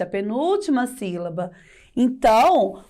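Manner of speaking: usual speaking voice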